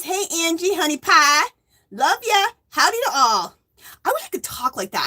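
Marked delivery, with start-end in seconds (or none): 0.0-3.6 high, lightly put-on Southern twang; attempted Southern accent. Streamer reading Granny D's greeting aloud, attempted Southern accent. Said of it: "I just can't do that Southern accent".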